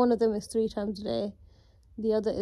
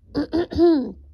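throat clearing; 7 semitones higher, 9 dB louder than usual. Real, not acted. Sore throat, after surgery.

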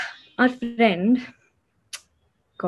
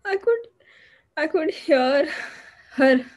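tearful; 6 semitones higher than usual. Acted. Recounting a friend's assault, starting to break.

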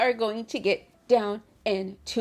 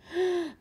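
sharp, high intake of breath; excited gasp; 9 semitones higher than usual. Real, not acted. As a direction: gasp at thrilling news. Delighted at Pastry Week announcement.

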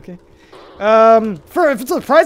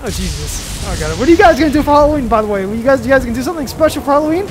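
ordinary talk

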